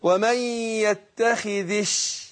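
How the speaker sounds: ومن يتخذش with the dhal kasra running into the shin, very slow; connected reading. Shown as a demonstration. Teacher to student showing the linked يتخذ الشيطان. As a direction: link يتخذ straight into الشيطان's shin, drawn out. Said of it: "فما بقول إيه؟".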